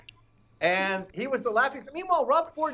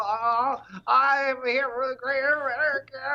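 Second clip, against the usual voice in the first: higher, whiny mock-tearful speech; mock crying Kennedy; 7 semitones higher than usual. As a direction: imitate a weepy politician. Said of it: "They all sound like they're crying".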